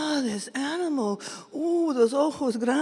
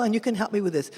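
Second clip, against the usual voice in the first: ordinary delivery, nothing marked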